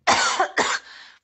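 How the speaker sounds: loud hacking cough; chesty cough. Real, not acted. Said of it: "Beg your pardon".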